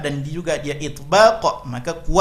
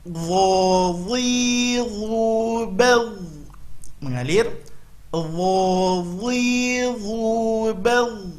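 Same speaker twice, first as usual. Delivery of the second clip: slow Quran recitation drill; 6 semitones higher, much slower than usual. Shown as a demonstration. Teacher leading students in a slow drill. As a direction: chant each syllable slowly and clearly.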